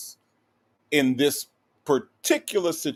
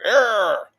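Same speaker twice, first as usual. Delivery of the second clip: loud, high mock-tough "arr"; mock gangster roar; playful gangster growl; 9 semitones higher, 4 dB louder than usual. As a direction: growl it out like a pretend tough guy. Playing up gangsterism.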